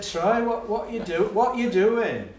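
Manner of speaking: high-pitched, pleading challenge; exasperated teacher. Retold or imitated. Teacher challenging a head-banging pupil, exasperated.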